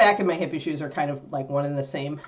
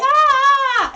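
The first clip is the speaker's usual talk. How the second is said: sudden high loud yelp; a sharp surprised cry; startled yelp; 9 dB louder than usual. Real, not acted. Dog leaps at her.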